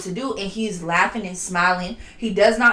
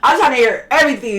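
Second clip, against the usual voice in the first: ordinary delivery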